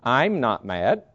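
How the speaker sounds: slow, drawn-out denial of anger; unconvincing denial. Shown as a demonstration. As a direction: stretch it out so nobody believes it. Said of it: "if I said it that way".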